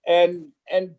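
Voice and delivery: ordinary talk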